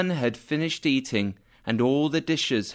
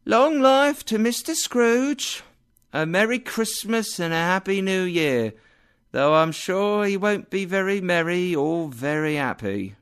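sarcastic toast; 7 semitones higher than usual. Acted. Wife's sarcastic toast to Scrooge.

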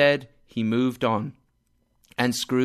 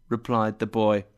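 usual speaking voice

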